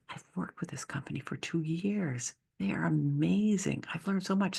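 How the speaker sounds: soft, whispered self-talk; talking herself into being grateful, whispered; inner voice. Retold or imitated. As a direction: say it to yourself, softly and privately.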